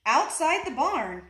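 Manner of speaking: ordinary delivery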